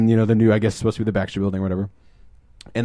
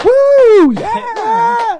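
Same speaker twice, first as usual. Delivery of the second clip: very high, loud whoop; hammed-up shrieking crowd celebration; mock audience cheer; 10 dB louder than usual. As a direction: holler like a fan going wild. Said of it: "Except nobody was like".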